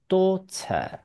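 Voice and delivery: "daughter" with a crisp T and no final R; British "daughter", ending in a plain schwa. Shown as a demonstration. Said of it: "英国人就是念daughter". (英式 daughter，T 清楚、不卷舌)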